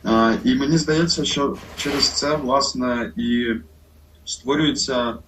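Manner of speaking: ordinary talk, nothing marked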